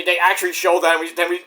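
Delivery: usual speaking voice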